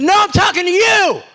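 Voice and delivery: loud, high retold shout; angry yell. Coach shouting at the umpire who ignored him, loud and high. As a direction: snap back at him hard.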